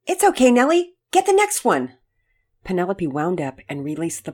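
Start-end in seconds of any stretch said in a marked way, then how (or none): 0.1-2.0 high, loud reassurance; encouraging call. A narrator's reading. Dad to daughter from the dugout, loud encouraging call. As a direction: call out support from a distance. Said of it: "Daddy Peters encouraged her from the dugout".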